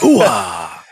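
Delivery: low drawn-out movie-star exclamation; Al Pacino. Acted. Friend pitching how the possessed actor should play it, over the top. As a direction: throw out an over-the-top star's "ooh-ah". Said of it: "done it like Al Pacino".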